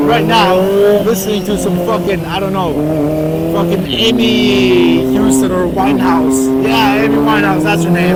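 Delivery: high, loud yelling on a motorbike; shouting over engine. Real, not acted. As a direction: yell over wind and engine noise. Shouting while riding motorbike.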